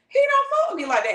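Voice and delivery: "fuck" hit high and loud, voice jumping up; high pitched whine. Acted. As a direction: repeat it in a high wail of disbelief. You doubting, said twice.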